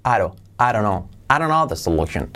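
"don't know" melted to a tap, no t; linked words. Shown as a demonstration. Teacher builds up the joined words, linked "I don't know the solution". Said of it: "añadir la unión de palabras".

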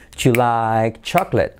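slow "Ju like chocolate", "like" stretched; stretched contracted take. Shown as a demonstration. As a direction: merge "Do you" into "ju" and draw out "like". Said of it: "Do you se convierte en you".

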